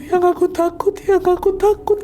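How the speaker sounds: high, tearful and scared; high voice of a weeping wife; frightened crying wife. Acted. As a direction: repeat the fear through sobs. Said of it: "tenangis-nangis Ria".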